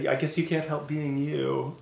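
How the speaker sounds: ordinary delivery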